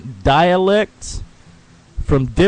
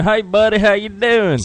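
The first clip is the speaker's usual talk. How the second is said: lightly voiced clerk, higher pitch; imitated store clerk; 7 semitones higher than usual. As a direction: imitate a clerk's greeting in a raised voice.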